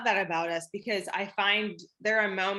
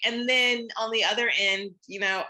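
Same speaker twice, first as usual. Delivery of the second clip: ordinary delivery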